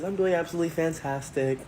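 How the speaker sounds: high-pitched, flamboyant cheer; flamboyant waiter. Retold or imitated. Waiter answering a customer's greeting, flamboyantly. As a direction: play an upbeat, flamboyant server. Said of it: "a very flamboyant man".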